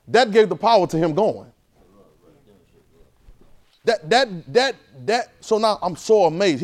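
loud, driving sermon voice; preaching at full force. Acted. Preacher drives his point to the church, loud and emphatic. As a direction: press the point home to the congregation.

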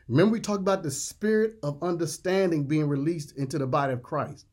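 ordinary talk, nothing marked

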